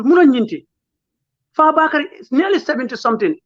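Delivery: normal talking voice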